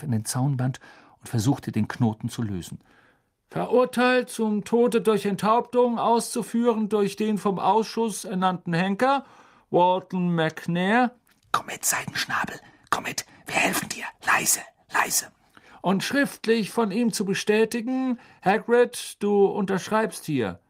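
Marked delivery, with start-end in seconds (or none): 3.5-11.1 high, formal proclamation voice; officious minister reciting an order; official reading aloud. Acted. Fudge reads out execution order. 11.5-15.3 urgent coaxing whisper; pleading, fully whispered. Acted. Harry coaxes hippogriff while hiding. 15.8-20.6 high, formal proclamation voice; officious minister reciting an order; official reading aloud. Acted. Fudge continues reading the order.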